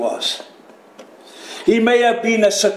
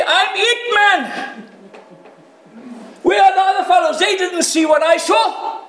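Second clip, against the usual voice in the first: mock boasting; 13 semitones higher than usual. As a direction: brag to the crowd like you're the chosen one.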